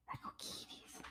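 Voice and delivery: breathy whisper to the cat; whispered baby talk. Pretend. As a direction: whisper it to the kitty.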